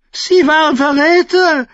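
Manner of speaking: very high, loud screech; shrieking. A narrator's reading. Young king protesting to his uncle from the ground, shrieking. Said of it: "kreischte Joffrey am Boden".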